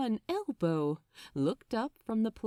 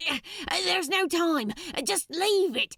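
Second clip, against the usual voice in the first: louder, much higher, urgent; urgent Crowley; 8 semitones higher, 3 dB louder than usual. Acted. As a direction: drag him out now. Crowley urging escape.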